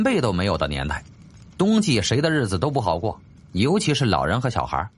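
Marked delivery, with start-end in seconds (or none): none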